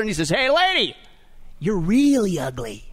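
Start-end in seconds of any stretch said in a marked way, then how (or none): 0.3-1.0 high, brash parrot hail; parrot calling out. Acted. Parrot spots the same lady again, calling out high. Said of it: "and the parrot, he sees her". 1.6-2.9 slow put-down, stretching "really"; parrot's insult. Acted. Parrot insults the lady again, slow and drawn out. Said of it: "and the parrot, he sees her".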